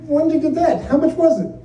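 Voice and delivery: wife interrogating husband over scotch; suspicious wife. Retold or imitated. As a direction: grill him like a wife. Wife questioning purchase.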